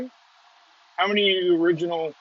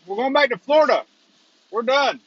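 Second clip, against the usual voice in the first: high-pitched, insistent retold reply; retold protest; 8 semitones higher, 4 dB louder than usual. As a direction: plead your case in a higher voice. Retold answer to the policeman.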